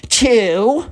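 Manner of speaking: high, stretched-out count; spoiled kid ultimatum. Acted. Spoiled kid counts down at Caroline, bossy ultimatum. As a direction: count in a high, bratty swoop.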